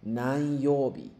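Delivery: Nanyoubi said slowly, each syllable clear, falling at the end; teacher spells out Nanyoubi slowly; slow, deliberate Nanyoubi for students; stress on Nanyoubi. Shown as a demonstration. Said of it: "lo importante es siempre".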